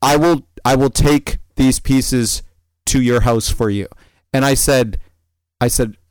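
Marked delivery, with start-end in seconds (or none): 0.0-3.9 put-on European accent. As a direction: attempt a Russian-like accent, word by word. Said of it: "a heavy European-ish accent".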